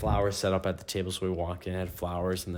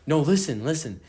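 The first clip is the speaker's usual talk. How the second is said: raised, pleading call to be heard; louder and higher, begging her to listen; 6 semitones higher, 5 dB louder than usual. Retold or imitated. Boyfriend begging girlfriend to hear him out, raised and pleading.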